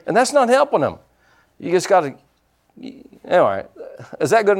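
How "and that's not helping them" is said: revved-up sermon voice driving the point home; fiery preaching. Acted. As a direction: preach it hard to the congregation. Preaching at full force.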